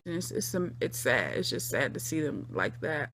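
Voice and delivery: soft, low and sad. Real, not acted. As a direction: let the sadness show quietly. Says it's sad.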